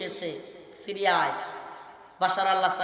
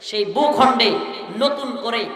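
preaching at full force; 3 semitones higher, 7 dB louder than usual. Acted. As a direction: thunder the list at the gathering. Climactic sermon to the crowd. (গলা চড়িয়ে জোশের ওয়াজ)

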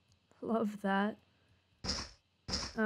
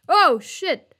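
loud startled yelp of a curse; 5 semitones higher, 11 dB louder than usual. Real, not acted. Gamer jumps at a sudden enemy, loud startled curse. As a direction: blurt it out, caught off guard. Monster suddenly appears in game.

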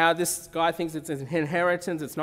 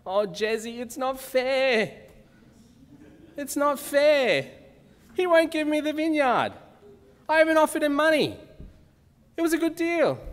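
whiny Ahab; 9 semitones higher, 3 dB louder than usual. Acted. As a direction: whine it like a pouting kid denied a toy. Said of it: "he might say something like this to her".

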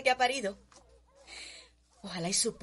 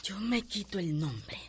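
normal talking voice

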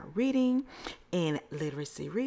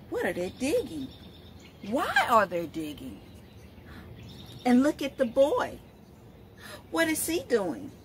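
put-on reading to children; 3 semitones higher than usual. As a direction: read it like story time on the rug. Reads picture book to children.

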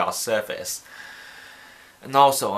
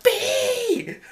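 mock fright; 3 dB louder than usual. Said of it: "He's like".

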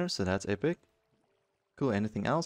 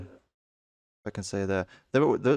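normal talking voice

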